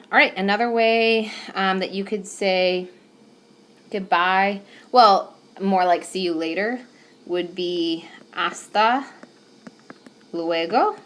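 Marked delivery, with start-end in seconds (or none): none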